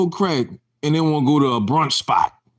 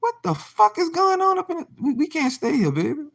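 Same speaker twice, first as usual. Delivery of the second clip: shocked quote; 11 semitones higher than usual. Retold or imitated. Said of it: "I'm like".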